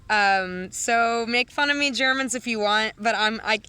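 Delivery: usual speaking voice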